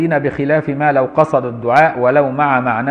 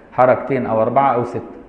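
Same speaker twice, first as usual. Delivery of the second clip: ordinary talk, nothing marked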